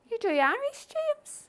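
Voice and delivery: very high, acted disbelief; astonished question. Congregant learns James does Irish, astonished. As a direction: squeal the question in mock astonishment.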